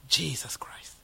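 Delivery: hushed, breathy whisper of the holy name; reverent whisper. Real, not acted. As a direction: whisper it in awe. Preacher drops to reverent whisper.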